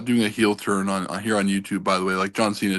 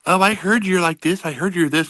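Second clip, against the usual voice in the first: higher-pitched mocking take on strangers; mimicked strangers; 7 semitones higher than usual. Random online strangers approaching him with gossip, mimicked higher. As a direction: put on a higher voice for nosy strangers. Voicing strangers in retold exchange.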